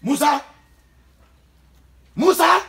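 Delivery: loud high call. Acted. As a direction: shout the name across the house. Calling out for the son.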